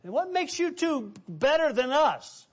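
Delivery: high, resentful challenge; indignant disciples. Acted. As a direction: demand it like jealous disciples.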